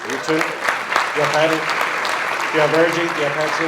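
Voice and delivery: loud call to the crowd. Real, not acted. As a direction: call the names out across the room. Calling out to aunts in audience.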